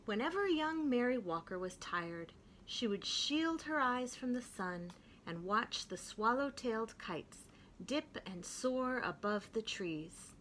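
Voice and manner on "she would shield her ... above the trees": ordinary delivery, nothing marked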